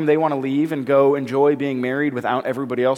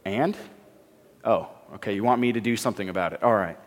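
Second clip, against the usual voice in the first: lower, softer, offhand Jesus; unbothered shrug; 8 semitones lower, 6 dB quieter, faster than usual. Retold or imitated. Pastor imagining Jesus answering his mother, offhand and soft. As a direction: shrug it off, then give in. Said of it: "picture Jesus looking back at her and being like".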